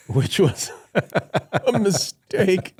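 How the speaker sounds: high, slow words through laughter; helpless laughing aside. Real, not acted. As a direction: crack up while telling it. Laughs at the story's comeback.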